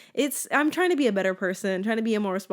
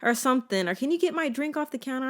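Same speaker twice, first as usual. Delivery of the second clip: lightly voiced mom, higher pitch; higher, imitated parental request; mom asking a favor; 8 semitones higher than usual. Mom asking for a fork or drink, lightly imitated. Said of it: "my mom will be like".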